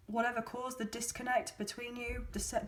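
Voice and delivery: ordinary talk, nothing marked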